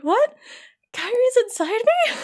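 cartoonish high-pitched disbelief; baffled Sora. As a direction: squeal it like a kid who can't believe it. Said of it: "Sora's just like".

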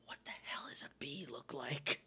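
hushed whisper to herself mid-test; panicked whisper. Retold or imitated. Test-taker whispering to herself at the SAT, whispered. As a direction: breathe it out with no voice, lost. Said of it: "and I was like".

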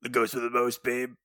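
low put-on movie ghoul voice; Beetlejuice. Co-host quoting the film after the comparison, low ghoulish voice. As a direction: drop low into a Beetlejuice impression. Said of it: "that voice is just Beetlejuice".

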